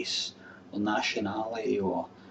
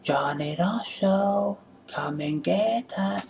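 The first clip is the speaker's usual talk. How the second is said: high, very slow sing-song call; woman calling her man in for dinner; woman calling out the window; 9 semitones higher, slower than usual. Shown as a demonstration. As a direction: call him in for dinner, drawn out. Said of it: "I'll shout out".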